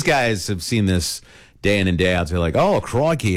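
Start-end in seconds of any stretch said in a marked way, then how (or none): 2.6-3.4 put-on light Australian accent, tossed off; a quick hint of an Australian "crikey"; mock Aussie crikey. Snake catchers in Australia greeting the python, light Australian accent. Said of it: "they get the accents and everything".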